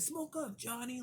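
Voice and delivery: high, cartoonish mock old man; hammed-up high-pitched imitation of the father. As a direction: squeak it out like a parody of the gruff dad. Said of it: "The old man grabbed and said".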